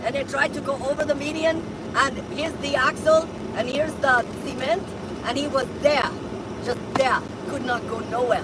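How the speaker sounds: light mock Russian accent; broken English, lightly Russian. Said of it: "You have a terrible Russian accent".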